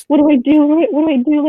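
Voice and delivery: mock panic calling her dad; imagined frantic call to dad; pretend freaking out. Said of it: "Like, freaking out".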